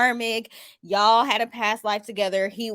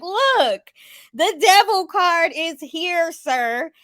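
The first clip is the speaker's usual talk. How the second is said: high, loud and slow, spelling it out; much higher gloating reveal to the boyfriend; triumphant told-you-so; 8 semitones higher, 5 dB louder, much slower than usual. Retold or imitated. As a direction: lay the card down like proof. Showing him the devil card.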